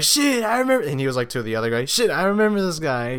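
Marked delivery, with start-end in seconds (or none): 0.0-0.9 high-pitched retold exclamation; excited recognition. Imitating a guy telling the other guy, excited recognition. 1.8-3.2 excited recognition. Retold or imitated. Retelling an old schoolmate's excited recognition.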